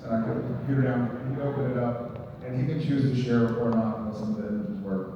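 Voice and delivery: ordinary delivery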